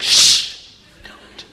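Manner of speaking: a whispered motherly hush; mom's shushing whisper. Retold or imitated. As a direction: hush him like a mom at the dinner table. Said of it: "my mom's like".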